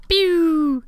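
high mock zap noise; a quick pew like a laser; pew sound effect.

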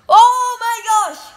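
loud, high shout of amazement; demonstrated shout. Shown as a demonstration. Vlogger shows viewers what to shout, loud and high. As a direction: belt it out high for the audience to copy. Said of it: "and shout".

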